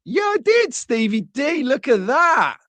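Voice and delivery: high, excited recognition; light up spotting a friend. Real, not acted.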